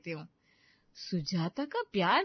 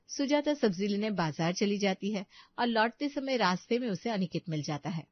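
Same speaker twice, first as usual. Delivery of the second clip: ordinary delivery, nothing marked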